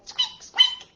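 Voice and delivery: very high tiny squeak squeak; mouse squeak. Acted. Storyteller squeaks for the mouse to the kids. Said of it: "the mouse said".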